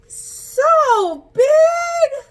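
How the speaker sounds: very high, loud, wailing cry of wonder; hammed-up shriek of awe; amazed shout. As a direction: blow the kids away with it. Elephant covers whole screen.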